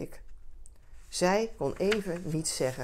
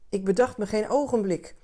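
plain reading voice

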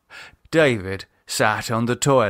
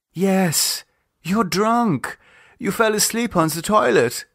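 Lisa's voice; 10 semitones higher than usual. Acted. Lisa voice.

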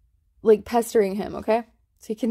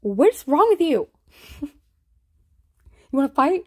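mock tough guy; 5 semitones higher, 5 dB louder, much slower than usual. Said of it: "he's like".

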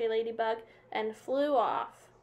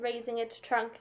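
plain reading voice

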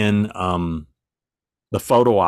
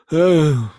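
imitated offhand "oh" of acknowledgment; indifferent "oh"; 7 semitones higher, 5 dB louder than usual. Imitating [name]'s reply on being introduced, indifferent grunt. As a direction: mimic a barely interested grunt of greeting. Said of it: "he just kinda goes ... Like that".

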